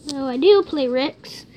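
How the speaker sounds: normal talking voice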